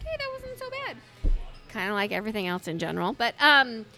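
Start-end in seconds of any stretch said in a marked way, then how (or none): 0.0-1.0 mock chipper. Retelling her thought after hike.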